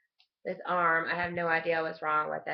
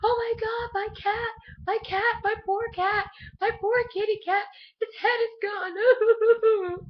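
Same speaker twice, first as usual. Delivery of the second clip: much higher, wailing mock sobbing mom; mom crying; 5 dB louder than usual. Imitating mom grieving her cat, high sobbing wail. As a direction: wail like a heartbroken mother over her cat. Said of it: "my mom's like".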